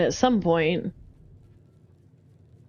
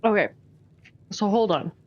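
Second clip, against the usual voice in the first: talking through a mouthful of food; words around a bite, chewing; chewing while talking. Real, not acted. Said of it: "Trying to eat and play at the same time".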